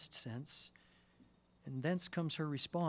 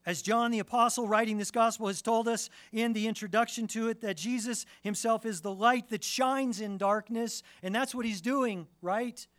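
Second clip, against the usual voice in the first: full-force preaching; 9 semitones higher, 10 dB louder than usual. Acted. Preacher urging the congregation, full-force preaching. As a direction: press the point home with full voice.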